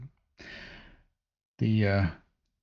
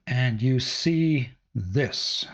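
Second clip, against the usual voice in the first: drawn-out slow reveal; dramatic slow reveal; slower than usual. Acted. GM teasing the party's entrance, slow and drawn out. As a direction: stretch it slowly for suspense. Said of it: "Why did you say it like that?".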